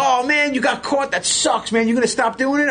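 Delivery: high-pitched sympathetic fan; super fan. Retold or imitated. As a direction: imitate a fan worked up for his idol. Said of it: "like a super fan".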